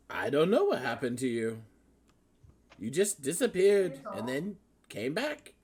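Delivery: lightly voiced puzzled mentor; a hint of Zephyros.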